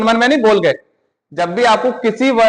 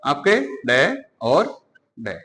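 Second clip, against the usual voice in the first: डे और डे said slowly and softly, each sound held; slow demonstration; 5 semitones lower, 6 dB quieter, much slower than usual. Shown as a demonstration. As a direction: lay out डे और डे slowly for the class. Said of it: "कौन कौन से?". (डे और डे धीरे-धीरे बोलें)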